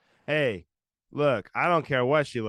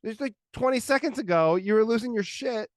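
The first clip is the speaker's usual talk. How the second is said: high-pitched, loud exasperated retort; mock lecture to his toddler, pitched high; exasperated dad; 10 semitones higher, 4 dB louder than usual. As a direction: pitch up, calling the kid out.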